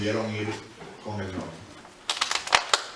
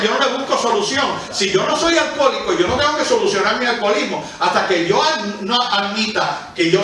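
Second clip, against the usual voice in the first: raised, louder preaching voice pressing the point; full-force preaching; 7 semitones higher, 5 dB louder than usual. Acted. Preacher to congregation about denying one's problem, full-force preaching.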